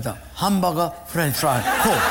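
put-on light Japanese accent, "Coke" as "koku"; "hamburger" said "hambaga", Japanese accent. Ordering breakfast from the waitress, Japanese accent. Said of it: "Japanese guy".

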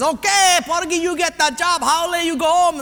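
high, brisk, final ruling; loud verdict. Acted. Personnel guy announces who gets hired, loud verdict. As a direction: deliver the decision at full voice.

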